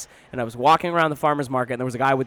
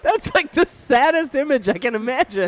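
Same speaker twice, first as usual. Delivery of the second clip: much higher, helpless with laughter; real laughter; 10 semitones higher than usual. Real, not acted. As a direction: squeeze the words out while laughing. Still cracking up at the image.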